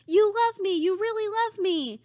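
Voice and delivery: high, gushing mock acceptance speech; mock Sally Field gush. Friend mocking the famous line, in a high gushing voice. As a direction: squeal it like a thrilled award winner. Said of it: "And it's".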